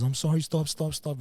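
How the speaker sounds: high, loud pleading; begging to stop. Retold or imitated. Injured kid begging the attacker to stop, high and loud. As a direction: cry out for him to stop.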